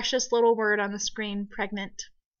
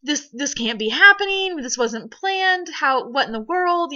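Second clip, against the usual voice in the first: high, stunned, stumbling disbelief; reenacted shock, pitched up; floored; 5 semitones higher, 3 dB louder than usual. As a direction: retell being bowled over, voice lifted and emphatic. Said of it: "floored, so floored".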